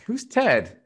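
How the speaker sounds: high squeaky mock question, dropping at the end; mock puzzled listener. Host mimicking listeners puzzled by the guest, high mock squeak. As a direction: squeak it like a baffled listener who doesn't know the guest. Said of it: "They're like".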